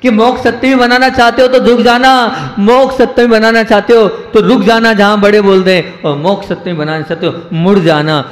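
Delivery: normal talking voice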